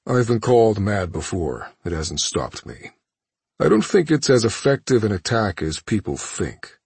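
dismissive imperious villain; cold villain voice. Acted. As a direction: brush it off coolly. Thanos dismisses captive.

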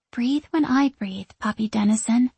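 plain reading voice

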